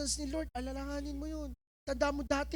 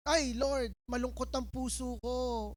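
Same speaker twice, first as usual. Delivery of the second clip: usual speaking voice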